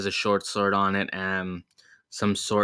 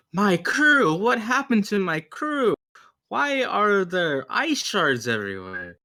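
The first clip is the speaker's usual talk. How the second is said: high, loud theatrical dismay; alarmed outcry; 12 semitones higher, 5 dB louder than usual. Acted. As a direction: cry out over your stricken crew. Yvette finds her crew frozen.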